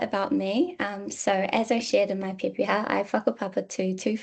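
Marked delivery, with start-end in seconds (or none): none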